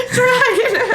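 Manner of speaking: high squeal of laughter; high laughing. Real, not acted. Laughing at friend.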